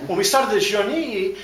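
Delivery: mock French lilt on "when we started this journey" with a chuckle; fake French accent. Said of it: "kill the fake French accent".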